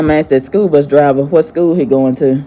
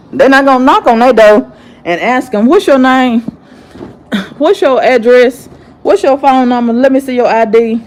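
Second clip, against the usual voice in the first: indignant raised voice; 7 semitones higher than usual. Real, not acted. Angry rant, raised voice.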